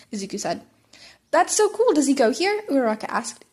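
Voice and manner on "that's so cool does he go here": high, loud delight; excited. Acted. Uraraka thrilled to hear Izuku has a twin, high and loud. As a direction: bubble over with enthusiasm.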